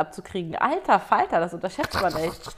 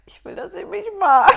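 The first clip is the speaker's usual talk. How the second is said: plaintive outburst; 10 semitones higher, 11 dB louder than usual. Real, not acted. Plaintive outburst after whisper.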